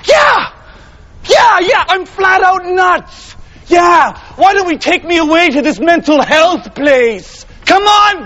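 high, wild shouting; manic outburst. Acted. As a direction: rave at the judge as if nuts. Defendant raves that he is nuts.

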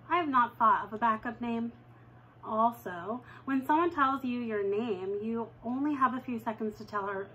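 ordinary delivery

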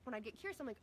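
ordinary talk

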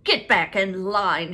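loud, slow barked order; shouted. A narrator's reading. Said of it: "he shouted".

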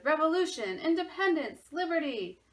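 loud, high rousing cries; shouted headlines. A narrator's reading. Newspapers shouting the revolution, loud and rousing. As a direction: call them out like headlines. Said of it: "Newspapers shouted".

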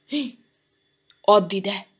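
sudden firm demand; commanding. A narrator's reading. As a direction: order it handed over. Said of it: "ráparancsolt Jankára".

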